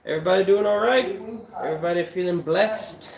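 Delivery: usual speaking voice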